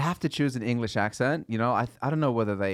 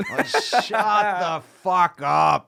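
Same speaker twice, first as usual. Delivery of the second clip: mock outrage; 7 semitones higher, 5 dB louder, slower than usual. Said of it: "mocking posh Cambridge talk".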